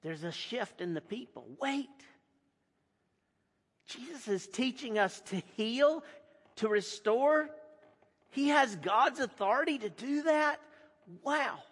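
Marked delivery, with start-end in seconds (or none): 1.6-11.6 higher, dawning wonder; a sudden realisation; amazed. Acted. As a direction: people in the crowd catching on, amazed. Crowd grasping the news.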